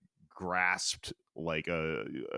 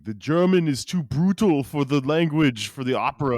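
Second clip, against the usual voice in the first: hammed-up Austrian accent, high and loud; Arnold Schwarzenegger impression; 9 semitones higher, 11 dB louder than usual. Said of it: "every time I do an impression of someone today, it's Arnold Schwarzenegger".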